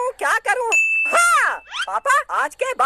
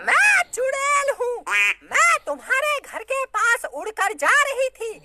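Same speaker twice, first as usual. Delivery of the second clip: mother hamming up a witch; 4 semitones higher than usual. Acted. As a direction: wail out the summons like a witch. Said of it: "क्या acting करती हो".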